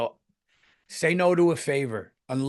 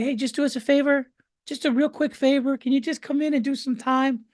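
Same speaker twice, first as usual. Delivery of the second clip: higher, louder coaxing voice; mock sweet request; 13 semitones higher, 5 dB louder than usual. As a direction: coax a quick favor, sugary. Mimicking a club owner asking.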